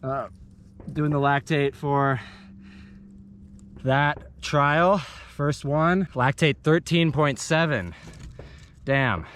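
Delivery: out of breath after a hard effort; winded, talking between breaths; after a workout. Real, not acted. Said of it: "that was hard".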